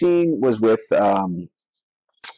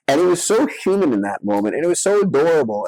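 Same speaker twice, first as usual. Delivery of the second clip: usual speaking voice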